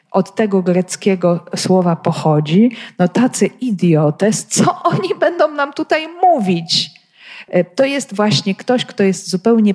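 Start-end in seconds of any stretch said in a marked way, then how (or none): 3.0-6.9 high-pitched mock indignation; scornful disbelief. Learned leaders dismissing simple Galileans, scornful and incredulous. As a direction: scoff in the voice of the learned elders.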